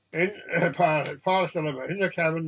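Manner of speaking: usual speaking voice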